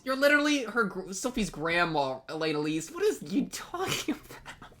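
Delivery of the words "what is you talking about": high, slow stunned disbelief; incredulous squeak. Real, not acted. Disbelief at grandma's line.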